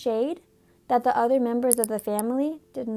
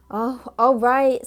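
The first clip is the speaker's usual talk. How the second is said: a loud, slow, reluctant give-in; grudging boy; 7 dB louder, slower than usual. A narrator's reading. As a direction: give in with a sulk. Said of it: "said George".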